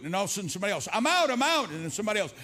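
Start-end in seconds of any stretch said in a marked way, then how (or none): 0.9-1.7 high, raised-voice mock cheer; excited calling out. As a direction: imitate someone yelling that they escaped. Others escaping the maze call out.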